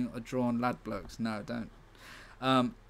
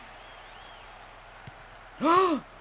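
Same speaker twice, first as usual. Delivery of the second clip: sharp gasp. Real, not acted. Reacting to a skill move.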